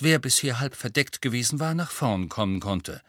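usual speaking voice

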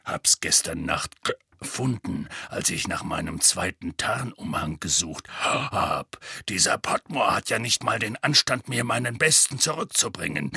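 put-on low, grumbling old Auror. Moody telling Harry where he found it, low and gruff.

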